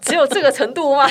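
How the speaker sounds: high, loud laughing disbelief; laughing scoff. Retold or imitated. Mock reply to the fan.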